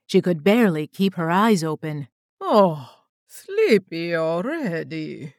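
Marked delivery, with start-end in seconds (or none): 2.4-5.3 slow, cooing Russian accent, lightly voiced; a light Russian accent, slow and teasing; heavy Russian accent. A narrator's reading. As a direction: coo it in a Russian accent like a mocking hunter. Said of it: "the hunter cooed in a heavy Russian accent".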